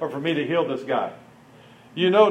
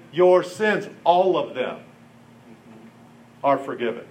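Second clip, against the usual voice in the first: gentle pronouncement; 4 semitones lower than usual. Retold or imitated. Jesus's words, said gently.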